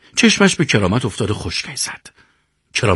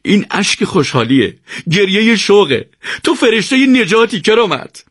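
tears of joy; 9 semitones higher than usual. A narrator's reading. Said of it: "یوسف به گریه افتاد".

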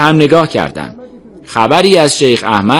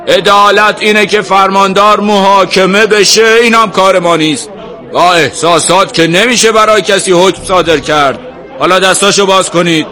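raised, higher authoritative voice; firm loud rebuttal; 9 semitones higher, 4 dB louder than usual. A narrator's reading. Sheikh ruling that judgement isn't theirs, firmly raised voice. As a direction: argue it out over the crowd. Sheikh loudly argues with the crowd.

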